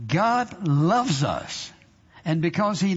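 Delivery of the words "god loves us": very slow, drawn out God loves us; slow stretched declaration; tender emphasis. Real, not acted. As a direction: linger on every word.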